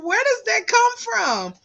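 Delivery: high-pitched disbelieving question; baffled at one's own accent; retold bafflement. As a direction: pitch climbing in mock dismay. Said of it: "And I was like".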